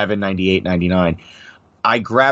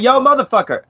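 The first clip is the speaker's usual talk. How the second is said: "Yo, motherfucker" called out much higher, as if across a store; calling across store; 13 semitones higher than usual. Retold or imitated. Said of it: "like that, uh, across the store".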